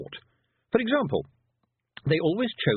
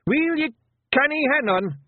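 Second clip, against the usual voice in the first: high, loud Scottish shout; exasperated wee Scotsman bellowing; 6 dB louder than usual. A narrator's reading. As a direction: yell at a whining bairn. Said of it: "shouted Rob Anybody".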